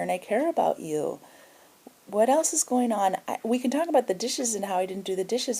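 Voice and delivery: reach out to her gently and kindly; gentle concern. Retold or imitated. Caring talk to upset roommate.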